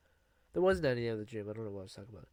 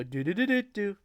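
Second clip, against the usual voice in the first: high, loud chanted do-do call; playful mock call; imitating his call; 8 semitones higher, 4 dB louder than usual. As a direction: sing out the call from up on dad's shoulders. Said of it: "I would do his calling".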